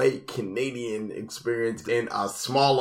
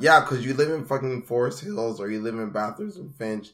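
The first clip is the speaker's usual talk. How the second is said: normal talking voice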